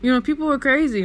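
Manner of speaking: high, insistent retold plea; protesting child. Child justifies herself to mom, protesting.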